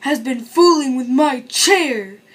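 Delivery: slow grumbling papa bear, a touch angry; growling papa bear. A narrator's reading. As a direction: drag it out with a bear's grumble. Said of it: "he growled, bristling".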